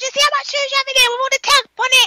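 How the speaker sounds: high, quick mock rant; imitated mad mother. Mimicking a Jamaican mother's shoe rant, mock mad. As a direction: mimic a mother on a rapid tirade. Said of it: "she was going mad".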